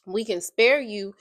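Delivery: ordinary talk, nothing marked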